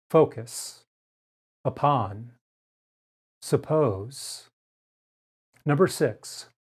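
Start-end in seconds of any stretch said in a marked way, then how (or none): 0.1-4.5 unstressed U in "upon" said as uh; careful word list; schwa demo words. Shown as a demonstration. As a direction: model each word slowly. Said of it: "but rather as uh".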